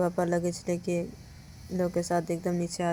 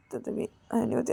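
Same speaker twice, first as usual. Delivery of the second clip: usual speaking voice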